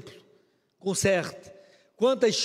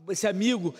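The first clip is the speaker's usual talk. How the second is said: ordinary delivery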